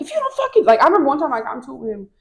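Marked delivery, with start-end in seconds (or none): 0.0-0.6 high-pitched imitation of a pushy coach; hammed-up gym orders in a raised voice; strength coach. Strength coach drives the class to lift, high and insistent. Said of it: "He'd be like".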